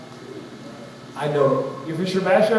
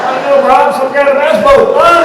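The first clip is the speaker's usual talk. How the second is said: higher and louder, yelling run; panicked shout; 8 semitones higher, 12 dB louder than usual. Pretend. Fisherman warning Rob about the bass boat, panicked shout. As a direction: scream a frantic warning.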